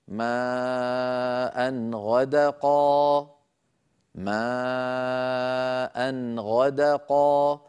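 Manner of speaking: slow chant of "ما أن غدقا", long stretched "ما", short tanween; clear izhar. Shown as a demonstration. Teacher showing tanween before ghayn, "ما أن غدقا" recited slowly with clear tanween. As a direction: hold the "ما", then move cleanly from "أن" into "غدقا". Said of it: "لاحظوا بأن التنوين كما".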